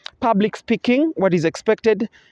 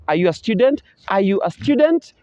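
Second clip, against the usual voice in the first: Are you a student, lifting on student; Are you a student with a rising end; rising intonation; 3 semitones higher than usual. Shown as a demonstration. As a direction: raise the pitch on student. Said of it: "take a rising intonation".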